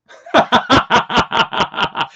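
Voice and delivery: big rolling laugh; loud laugh. Real, not acted. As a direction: burst of loud cackling laughter. Laughs at Bible joke.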